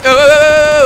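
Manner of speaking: loud, high run of yelps; alarmed yelling. Real, not acted. Player under sudden fire, alarmed yelping. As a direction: yelp repeatedly in alarm.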